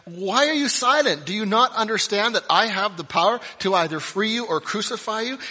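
loud, high exasperated demand; frustrated Pilate. Retold or imitated. Pilate confronting a silent Jesus, loud and exasperated. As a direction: bark at him as a fed-up governor. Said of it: "Pilate got all frustrated with him".

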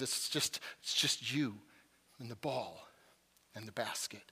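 fading to a near whisper; soft, fading words of total focus; hushed focus. Retold or imitated. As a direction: shut the crowd out and sink into calm. Calm focus at the free throw.

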